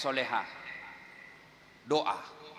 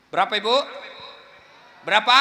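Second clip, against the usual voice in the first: loud call to the audience; 6 semitones higher, 8 dB louder, faster than usual. Real, not acted. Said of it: "asks audience".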